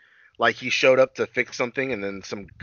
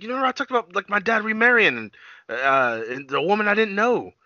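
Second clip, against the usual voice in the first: higher-pitched puzzled reminder; 8 semitones higher than usual. Retold or imitated. O'Brien reminds the doctor about his dad, higher and puzzled. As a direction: remind him, voice raised in puzzlement. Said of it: "He's like".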